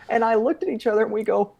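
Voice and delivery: ordinary delivery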